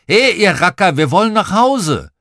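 high, loud hail; calling up from below. A narrator's reading. Dad calls the children down from below, hailing shout. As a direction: call up to the kids on the rocks. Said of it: "jemand von unten gerufen hätte".

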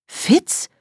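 Fitz hoch und verblüfft gerufen; überrascht. Acted. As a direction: Fitz mit steil hochschnellender Stimme ausrufen. Überraschter Ausruf beim Anblick des Kobolds. (Fitz als erstaunter Ausruf, ganz hoch angesetzt)

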